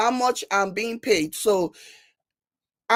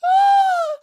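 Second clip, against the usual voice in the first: loud high "ah" after laughing; long sigh of hilarity; high laughing whoop; 6 dB louder than usual. Real, not acted. Gasping after a laughing fit.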